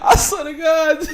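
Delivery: high-pitched, loud helpless laughter; cracking up, almost crying with laughter; laughing hard. Real, not acted. Cracking up at the clip.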